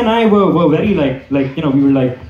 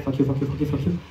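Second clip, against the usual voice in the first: swallowed fuck you, much softer; barely voiced inner fuck you; muttered curse; 6 semitones lower, 10 dB quieter, faster than usual. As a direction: bite back the curse under your breath. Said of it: "it was like".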